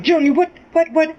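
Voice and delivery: rising, alarmed questions; a mother's panicked what; retold alarm. Mother hearing her son's confession, alarmed and rising. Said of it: "I'm like".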